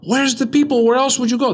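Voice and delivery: high, loud, incredulous shouting; acted outcry of disbelief; astonished drunk. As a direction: shout the retold drunk's questions high and insistent. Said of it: "They're drunk".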